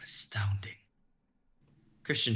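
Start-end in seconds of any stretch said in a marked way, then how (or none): 0.0-0.7 awed whisper. Acted. Awed reaction to spell.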